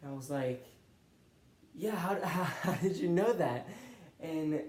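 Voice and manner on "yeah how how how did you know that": laughing disbelief. Retold or imitated. Stunned by professor's prophetic knowledge.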